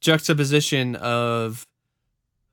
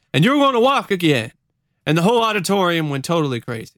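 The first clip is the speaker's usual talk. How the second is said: animated, higher storytelling voice; testimony read aloud; 6 semitones higher than usual. Acted. Witness recalls a wheelchair healing, animated and building. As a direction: build excitement as you retell a miracle. Reads testimony, voicing the preacher.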